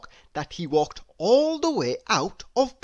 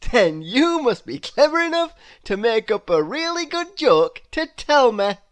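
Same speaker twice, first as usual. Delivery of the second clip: high, loud delighted cry; bubbling over with glee; Mister Happy thrilled at the news; cheerful Mister Happy; 6 semitones higher, 7 dB louder than usual. Acted. Mister Happy asks for joke.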